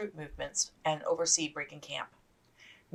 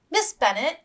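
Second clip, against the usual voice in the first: higher, cheery inquiry; bright question; 12 semitones higher, 4 dB louder than usual. Acted. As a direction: ask brightly and sociably. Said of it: "Miss Forster asked brightly".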